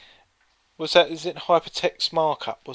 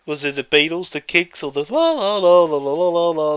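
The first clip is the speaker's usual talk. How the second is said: louder, hammed-up quiz contestant babble; baffled contestant; 6 dB louder than usual. Answering the quiz question, hammed-up contestant voice. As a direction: play the dithering contestant, breaking into la-la-la.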